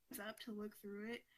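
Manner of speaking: ordinary talk, nothing marked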